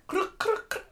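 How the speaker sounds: clicky clunk noises with the mouth; imitated clunking. Describing restock ASMR videos, imitating the clunks. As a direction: mimic items being stacked.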